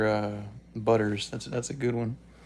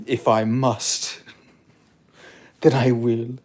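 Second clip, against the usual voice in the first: slow and much louder, with a laugh breaking in; hammed-up heroic vow; mock noble acceptance; 8 dB louder, slower than usual. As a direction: accept the destiny grandly. Guru accepts his fate.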